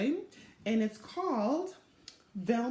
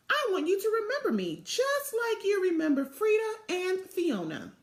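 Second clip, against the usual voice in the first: put-on young Velma; 10 semitones higher, 3 dB louder than usual. Child Velma pleads with principal.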